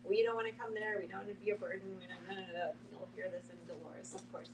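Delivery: normal talking voice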